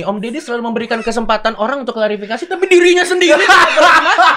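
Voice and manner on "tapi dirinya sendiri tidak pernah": high, loud and laughing; raised, laughing accusation; laughing tease. Real, not acted. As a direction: tease him loudly through laughter. Teasing host amid laughter.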